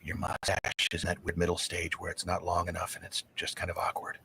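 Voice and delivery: imitating a wife's hushed, low remark; very soft and low, as if into an ear; retold whisper. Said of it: "she whispers in my ear".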